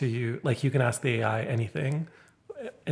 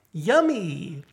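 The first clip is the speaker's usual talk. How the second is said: cutesy TikTok voice; 6 semitones higher, 4 dB louder than usual. Retold or imitated. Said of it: "sort of cutesy TikTok style".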